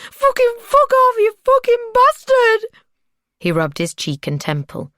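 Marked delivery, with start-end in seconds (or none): 0.1-2.6 high, loud, repeated screams; screamed. A narrator's reading. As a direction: scream each word harder. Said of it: "Maggie screamed".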